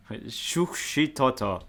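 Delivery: drawn-out, slow mock-Japanese pronunciation; Japanese-like accent. Real, not acted. Vlogger guesses a Salvadoran city's name, Japanese-like accent. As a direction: say it slowly as if it were Japanese. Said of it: "saying it like it's Japanese".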